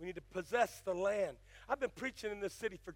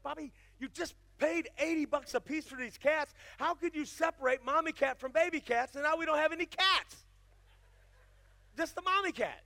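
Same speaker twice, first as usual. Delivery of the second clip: higher, louder exasperated scolding of Bobby; 8 semitones higher, 7 dB louder than usual. Retold or imitated. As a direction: throw up your hands at him, a retold tirade. Scolding husband over lost cats.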